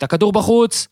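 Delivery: a hammed-up line call from the baseline; retold shout. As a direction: call the ball out like an indignant wife. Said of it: "לאה הייתה צועקת".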